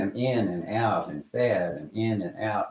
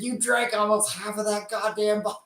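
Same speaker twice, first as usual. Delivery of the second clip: much higher, mock disbelief; imitating shocked partner; 13 semitones higher than usual. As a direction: squeak it out like he can't believe the bottle is half gone. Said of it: "He said".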